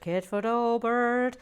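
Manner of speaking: put-on light Irish accent with hissy S sounds; a touch of Irish brogue on the name. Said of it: "he's got an Irish accent with a very sibilant S".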